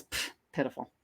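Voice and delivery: low, soft scoff; dismissive. Retold or imitated. Director judging the actress's attempt in her trailer, dismissive. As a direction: puff it out under the voice, unimpressed.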